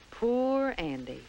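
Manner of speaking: ordinary delivery, nothing marked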